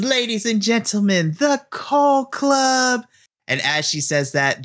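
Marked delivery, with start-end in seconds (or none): 0.0-3.0 ring announcer. Acted. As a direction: announce them to the whole arena. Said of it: "and says".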